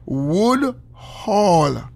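"Wood Hall" said slowly, stretched out; slow, deliberate "Wood Hall"; slow demonstration. Shown as a demonstration. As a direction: draw out each word of "Wood Hall". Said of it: "That's how me say it slow".

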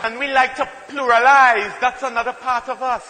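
ordinary talk, nothing marked

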